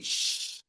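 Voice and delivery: "X" as a long shh opening into "i"; stretched "xi"; joined sounds. Shown as a demonstration. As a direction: hold sh, then blend into an ee. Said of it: "Faz o I".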